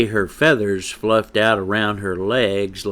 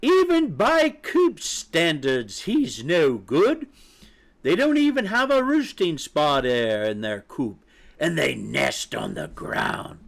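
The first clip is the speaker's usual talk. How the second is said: disdainful mother hen; 7 semitones higher than usual. Acted. Mother hen looks down on coop chicks, disdainful. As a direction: sneer at their lodgings, pitched up.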